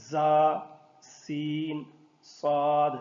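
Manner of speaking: زا، سین، صاد with a sharp hissing whistle; whistling letters. Shown as a demonstration. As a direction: let زا، سین، صاد whistle through the teeth. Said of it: "ان میں سیٹی کی آواز ہے". (سیٹی کی آواز کے ساتھ زا، سین، صاد)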